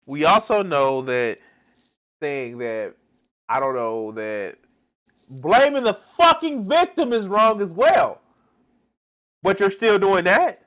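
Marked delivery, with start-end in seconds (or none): 5.3-10.6 loud, high, furious yelling; real exasperated shout; angry shout. Real, not acted. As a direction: blast her for blaming the victim. Angry outburst at the video.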